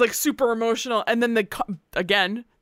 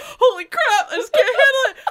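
much higher, louder, laughing through mock tears; overwhelmed, teary; 6 dB louder than usual. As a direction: overcome by the Disney movie, half laughing, half crying. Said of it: "And I'm just like".

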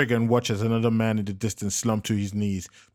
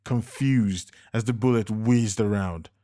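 ordinary delivery

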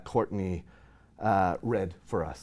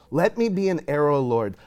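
fervent prayer; 4 semitones higher, 6 dB louder than usual. Retold or imitated. Reading journal prayer aloud.

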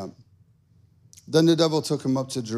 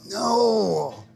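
mock kid whine; 7 semitones higher than usual. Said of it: "we're like".

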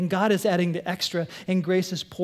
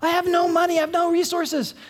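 higher, louder complaint; mock protest; 9 semitones higher, 5 dB louder than usual. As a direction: protest your lack loudly. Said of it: "All of a sudden".

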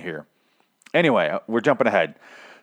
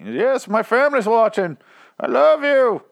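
very high, slow, louder put-on voice; mock Bane newscaster; 12 semitones higher, 4 dB louder, slower than usual. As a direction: overact the anchor's sappy farewell. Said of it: "Bane was on the news".

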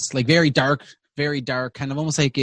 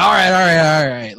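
bartender intervening; 2 semitones higher, 6 dB louder, faster than usual. Acted. Bartender quiets rowdy drinkers, raised voice. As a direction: call the room to order. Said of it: "the bartender's even trying".

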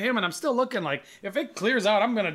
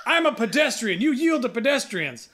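higher, insistent mock outrage; indignant scooter rider; 4 semitones higher, 3 dB louder than usual. Scooter rider demands right of way from driver, indignant. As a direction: imitate a self-righteous rider, slowing on the demand. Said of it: "He goes".

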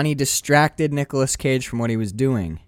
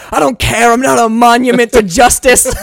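high, loud, grandiose outburst; mock furious shout; 9 semitones higher, 10 dB louder than usual. [name] snapping back at [name], mock furious shout. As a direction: bellow it like a melodramatic tragic hero. Acting out [name]'s shouted retort.

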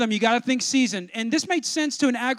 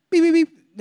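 higher, louder imitated beeps; treadmill beeps; 5 semitones higher, 5 dB louder than usual. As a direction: punch the beeps up as the race heats. Imitating treadmill buttons, escalating.

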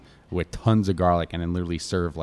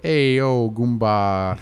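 Bronx Italian; 4 semitones higher, 6 dB louder, much slower than usual. Retold or imitated. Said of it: "They talk like that".

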